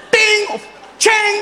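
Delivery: high, loud metallic ringing syllables; drawn-out ringing clang; clanging wok imitation. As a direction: mimic a pan hitting the floor. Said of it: "Nou, dat geluid, zo heet dat kind".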